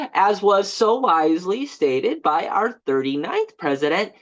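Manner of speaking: normal talking voice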